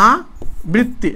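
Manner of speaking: "আবৃত্তি" very slowly, aa, then brit-ti with double ত; doubled-consonant demo. Shown as a demonstration. Teacher showing the ত comes twice in "আবৃত্তি", slowly demonstrating. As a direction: stretch "আবৃত্তি" out in parts, rising at the end. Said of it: "আবৃত্তি মানে ত দুইবার হচ্ছে".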